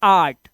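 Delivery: "आर्ट" firmly with a long aa; correct art. Shown as a demonstration. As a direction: settle on "आर्ट", open vowel, falling. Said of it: "इसे हम पढ़ते हैं आर्ट".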